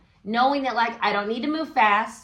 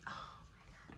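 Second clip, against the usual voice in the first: a stunned, breathless whisper; awed whisper; 28 dB quieter than usual. Real, not acted. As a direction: gasp it out under your breath in disbelief. Amazed by the card that fell.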